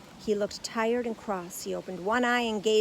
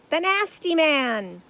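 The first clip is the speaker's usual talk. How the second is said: patient; 8 semitones higher, 12 dB louder, slower than usual. A narrator's reading. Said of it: "said Paddington patiently".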